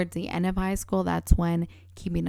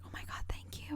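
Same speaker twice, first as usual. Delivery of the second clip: breathy grateful whisper; 16 dB quieter, faster than usual. Retold or imitated. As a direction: whisper your thanks softly. Grateful reaction quoted, whispered.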